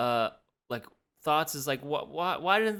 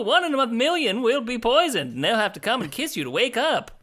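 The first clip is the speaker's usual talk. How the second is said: put-on old-timey announcer pitch, higher and louder; vintage radio ad pitchman; 1950s narrator; 8 semitones higher, 3 dB louder than usual. S0 hawks poison apples, announcer voice.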